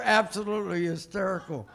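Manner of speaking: ordinary talk, nothing marked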